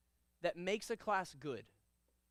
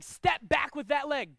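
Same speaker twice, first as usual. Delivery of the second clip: loud, slow, higher shout; shouted command; 5 semitones higher, 10 dB louder, slower than usual. Shown as a demonstration. Instructor demoing a command for students, slow and shouted. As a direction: drill it out to the class. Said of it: "Everybody watch me".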